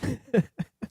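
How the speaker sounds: soft, high giggle; quiet high-pitched snickering; laughter. Real, not acted. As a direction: giggle softly to yourself. Cracking up after prank call.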